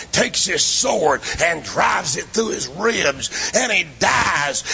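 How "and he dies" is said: usual speaking voice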